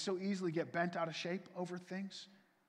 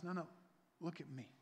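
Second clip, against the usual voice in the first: much softer, hushed; gentle God voice; 10 dB quieter, faster than usual. Acted. God calling distracted believers, soft and gentle. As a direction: gently call them back.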